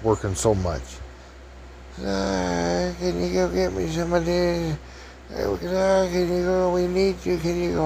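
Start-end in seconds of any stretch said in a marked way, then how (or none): 2.0-7.8 high, put-on voice for the maid; lightly pitched-up woman asking for help; high mock maid voice. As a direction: squeak it out like the hotel maid. Maid voiced in retold story.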